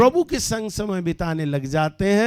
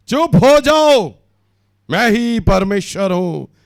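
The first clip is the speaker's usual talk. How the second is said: commanding proclamation; 2 semitones higher, 8 dB louder than usual. Acted. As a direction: thunder out God's command to the crowd. Preacher proclaims God's command.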